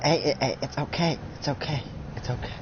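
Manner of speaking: soft, hushed reassurance; soothing. Acted. Character calms a friend who wants to kill, soothing. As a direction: gently talk someone down, very quietly. Soothing upset Rayton in roleplay.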